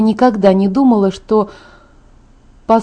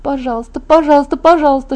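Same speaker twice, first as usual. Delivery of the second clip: ordinary delivery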